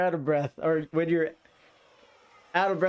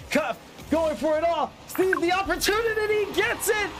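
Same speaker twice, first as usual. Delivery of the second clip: excited goal call; 13 semitones higher than usual. Real, not acted. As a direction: scream the clutch goal, repeating the name. Caster calls the winning goal.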